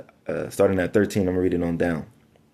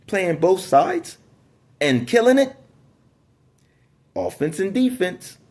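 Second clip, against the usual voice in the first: excited preaching; 9 semitones higher, slower than usual. Real, not acted. Preacher hailing a good sign to listeners, excited. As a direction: pump up the crowd on the last words.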